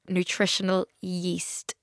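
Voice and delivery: "Nutritional yeast" drawn out slowly and softly; slow careful repeat. Shown as a demonstration. Podcaster repeating for puzzled listeners, "Nutritional yeast" said slowly and softly. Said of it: "What did you just fucking say".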